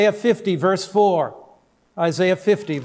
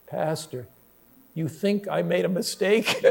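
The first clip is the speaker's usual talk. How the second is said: much softer, lower, a small laugh in it; sheepish quiet question; 3 semitones lower, 6 dB quieter than usual. Retold or imitated. Convert sheepishly asking his pastor, soft and low. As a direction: ask it sheepishly under your breath.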